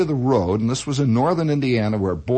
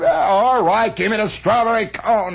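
exasperated; 6 semitones higher, 3 dB louder than usual. Retold or imitated. Exasperated adult answers wailing kid.

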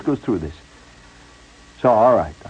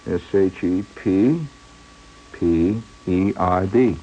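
patient letter-by-letter spelling; slow spelling; 2 semitones lower, slower than usual. Retold or imitated. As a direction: spell each letter slowly and evenly. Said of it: "spelling out letters".

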